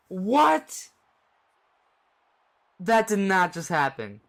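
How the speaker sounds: very high, loud stunned "What?"; can't believe what just happened; shocked laughter. Real, not acted. Game lost on last play.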